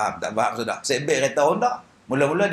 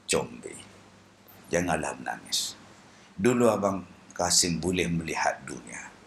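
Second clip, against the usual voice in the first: much lower, softer voice consoling Combi; blind Kasim, comforting; 10 semitones lower, 5 dB quieter than usual. Acted. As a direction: comfort her low and gently as blind Kasim. Blind Kasim comforts the crying widow. (Kasim memujuk Combi dengan suara rendah)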